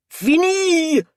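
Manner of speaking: high, loud wail of despair; anguished cry. A narrator's reading. Hugo pounds his chest before them, anguished.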